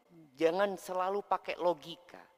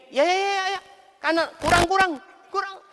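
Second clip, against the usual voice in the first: much higher, louder calling out; staged photo director; 11 dB louder, faster than usual. Retold or imitated. Photographer setting up wedding photos, loud high directions. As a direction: direct the wedding shoot loudly. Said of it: "ada yang nyeting".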